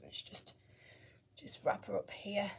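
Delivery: soft unvoiced whisper. Real, not acted. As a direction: whisper so as not to wake her. Wrapping the doll gently.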